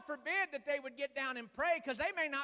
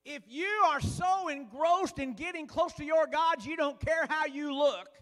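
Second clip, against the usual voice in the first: louder, fervent sermon delivery; rising preaching; 2 semitones higher, 6 dB louder than usual. Acted. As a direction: preach it out to the pews. Preacher building to full force.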